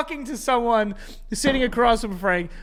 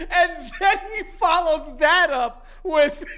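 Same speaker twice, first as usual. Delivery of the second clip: high voice squeezed by laughter; real giggles breaking up the words; laughing while talking; 5 semitones higher than usual. Real, not acted. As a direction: barely get it out for laughing. Cracking up while telling story.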